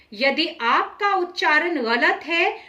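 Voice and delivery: usual speaking voice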